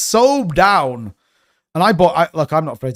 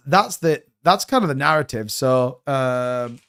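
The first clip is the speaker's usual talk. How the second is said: ordinary talk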